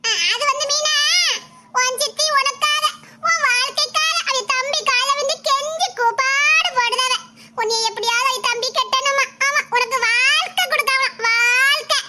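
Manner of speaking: sugary stepmother act. Acted. Stepmother sweet-talking Meena into marrying her brother, slow and syrupy. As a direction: lay on the false sweetness, stretching each phrase. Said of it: "என்ன நாடகம் ஆடில".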